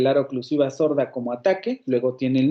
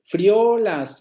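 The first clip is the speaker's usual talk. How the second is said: Frío with a high, loud stress on the final o, frió; verb stress; 6 semitones higher, 4 dB louder, much slower than usual. Shown as a demonstration. Teacher shows the verb form to the class. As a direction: push the last o of Frío up and out. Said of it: "Ese es el verbo de freír".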